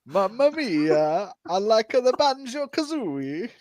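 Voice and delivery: mock Italian accent. Mocking Fabio for being called Italian, in a hammed-up Italian accent. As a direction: tease a friend with a cartoon Italian voice. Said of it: "why am I Italian?".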